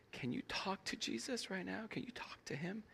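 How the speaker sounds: soft hushed whisper. Retold or imitated. Pastor gently whispering to a weeping man, hushed. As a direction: breathe it gently so as not to break the moment. Quietly urging him to pray.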